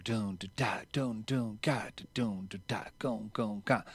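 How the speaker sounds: soft spoken drum syllables; vocalized triplet rhythm. Retold or imitated. Drummer illustrating the end of each measure, soft vocal drum imitation. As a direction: quietly imitate the drum fill with your voice. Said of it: "throws in that quarter note triplet".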